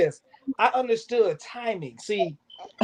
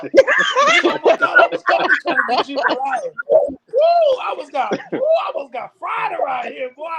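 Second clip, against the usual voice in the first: laughing shout; 8 semitones higher, 3 dB louder than usual. Real, not acted. Playful teasing about lightning bolt.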